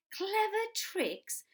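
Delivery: very high, loud, slow scoff; mocking disbelief. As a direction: echo it in disbelief. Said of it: "cried the not so big one".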